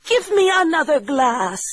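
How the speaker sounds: high, pleading widow voice; excited cry. Acted. Widow begging the doctor for another glass, high excited cry. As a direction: beg in a high put-on voice. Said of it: "she cried".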